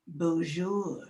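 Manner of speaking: deadpan French bonjour; Joan of Arc impression. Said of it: "This is my impression of Joan of Arc".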